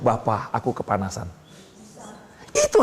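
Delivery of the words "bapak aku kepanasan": soft prayer. Acted. Voicing a personal prayer.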